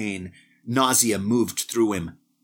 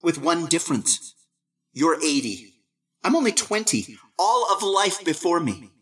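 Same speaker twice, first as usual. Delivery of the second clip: put-on a lightly higher, cocky young voice; young Barton, a touch boastful; young man boasting to his old self, lightly higher; 6 semitones higher than usual. A narrator's reading.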